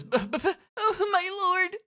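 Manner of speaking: very high, stammering; stutter, then wail it high; flustered dismay. Acted. Nagi as her lord leaves, flustered.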